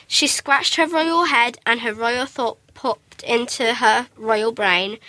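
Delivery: usual speaking voice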